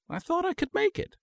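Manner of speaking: high, small guilty voice; a hint of a caught wife; sheepish excuse. A narrator's reading.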